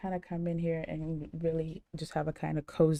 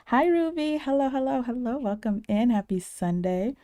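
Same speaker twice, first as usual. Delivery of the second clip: high, loud cheery hello; excited greeting; 5 semitones higher, 6 dB louder than usual. Real, not acted. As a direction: beam a big welcome to a friend. Greeting viewer in chat.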